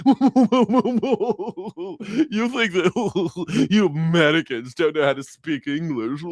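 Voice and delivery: smug mock British scoff through laughter. Brit mocking Americans' English, smug laughing scoff. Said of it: "they're like".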